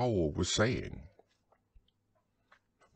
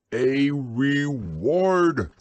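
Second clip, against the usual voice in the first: loud, high and very drawn out; slow, emphatic loud announcement; 4 semitones higher, 6 dB louder, much slower than usual. A narrator's reading. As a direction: boom it out slowly over the interruption. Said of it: "said Owl very loudly".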